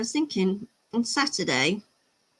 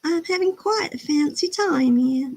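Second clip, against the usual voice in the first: higher, hammed-up Southern belle accent; mock Southern belle accent, pitched up; 6 semitones higher than usual. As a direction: play a coquettish Southern belle, drawing out the vowels. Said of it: "all I do is quote Scarlett O'Hara".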